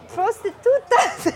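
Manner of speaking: drawn-out Spanish "Prostitutas"; retold man spelling it out; slow Spanish word. Man says word, slowed.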